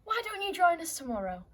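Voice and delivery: usual speaking voice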